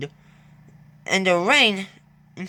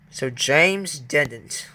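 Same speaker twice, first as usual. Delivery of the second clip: plain reading voice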